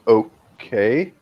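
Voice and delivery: drawn-out okay with a questioning rise; hesitant, baffled acknowledgement; awkward. Acted. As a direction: stretch it out, unsure how to respond. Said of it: "Awkwardly, like, stare at him".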